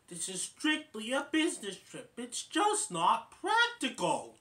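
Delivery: lightly voiced exasperated father; Dave scolding. Dave telling Alvin the trip is business, mildly stern. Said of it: "And then Dave says".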